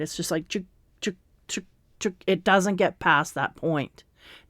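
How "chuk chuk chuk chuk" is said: soft clicking "chuk" like a stuck record; skipping record noise. Retold or imitated. Reader imitating a stuck record for viewers, soft clicks. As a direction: tap out a record skipping, quietly. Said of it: "they're showing me a skipping record".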